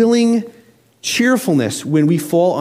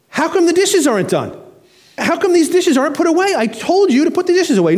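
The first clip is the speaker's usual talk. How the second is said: much higher, mock nagging wife; annoyed wife; 12 semitones higher than usual. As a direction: play the exasperated wife, pitched way up. Wife scolding about the dishes.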